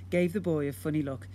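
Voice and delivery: ordinary delivery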